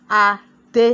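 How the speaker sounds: "adé" split into two separate, firm beats; broken into syllables. Shown as a demonstration. Teacher showing how to count syllables, "adé" beaten out. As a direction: beat out "adé" syllable by syllable with a gap. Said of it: "you beat the word".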